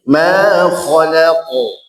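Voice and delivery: slow Ma khalaqo, light LA and heavy QO; thin la, thick qaf. Shown as a demonstration. Teacher models Ma khalaqo slowly, thin la, thick qaf. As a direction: stretch Ma khalaqo, thinning la, thickening qo. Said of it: "Lanya tipis, qafnya tebal".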